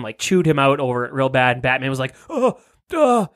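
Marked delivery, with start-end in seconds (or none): 2.3-3.3 high, slow old-man mock voice; creaky cartoon principal impression; Principal McDicker voice. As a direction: play Batman as a cranky old principal. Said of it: "the Principal McDicker from fucking Beavis and Butthead".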